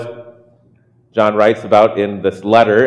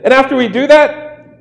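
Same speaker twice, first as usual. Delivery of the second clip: high, faster mock schemer voice; scheming disciple; 10 semitones higher, 3 dB louder, faster than usual. Disciple laying out the plan to the group, high and quick. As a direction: rattle off the plan like a cartoon plotter. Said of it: "And he said".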